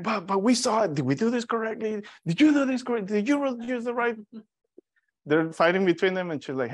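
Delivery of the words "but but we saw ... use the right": higher, flustered voices accusing each other; bickering doctors. Retold or imitated. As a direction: toss the blame back and forth, rattled. Doctors bickering over the test.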